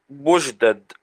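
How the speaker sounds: usual speaking voice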